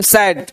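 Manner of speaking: सैड as one single syllable. Shown as a demonstration. Teacher showing a one-syllable word, सैड in one go. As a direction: say सैड in one unbroken beat. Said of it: "एक बार में".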